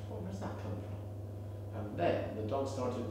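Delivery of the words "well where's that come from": hushed puzzled question. Retold or imitated. Puzzled at perfume smell.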